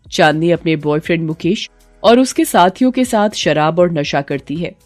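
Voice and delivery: plain reading voice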